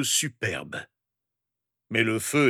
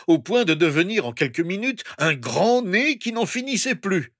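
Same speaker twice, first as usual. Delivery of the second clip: usual speaking voice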